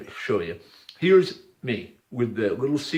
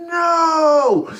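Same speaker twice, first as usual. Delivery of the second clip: high, loud screamed NO; screaming no; 6 dB louder than usual. Retold or imitated. Hitler screaming no at losing the spear. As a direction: scream it like a man losing everything.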